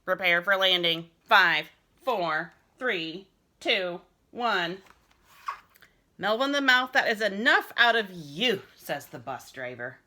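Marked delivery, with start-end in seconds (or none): 0.0-4.8 a light robot countdown. Pretend. Boy pretending to be a robot warning his crew, light robot voice. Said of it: "in my robot voice". 6.2-8.6 a loud, high scold from the bus driver; stern scolding. Acted. Bus driver scolding a noisy boy, loud stern telling-off.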